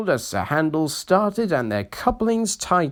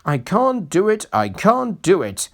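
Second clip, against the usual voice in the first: grumbling; 7 semitones higher, 3 dB louder than usual. A narrator's reading. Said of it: "he grumbled".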